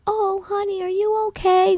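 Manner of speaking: high, cooing fuss over someone crying; mock concern. Acting out everyone's concerned reaction.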